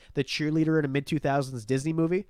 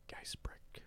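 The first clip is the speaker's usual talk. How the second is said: near-whispered, trying out the name; tentative name attempt; 22 dB quieter than usual. Real, not acted. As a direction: sound it out under your breath. Trying out a viewer's surname.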